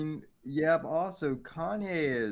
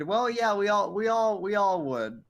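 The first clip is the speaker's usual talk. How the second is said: excited; 8 semitones higher, 7 dB louder than usual. Real, not acted. Eager agreement with chat joke.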